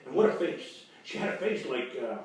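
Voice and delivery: normal talking voice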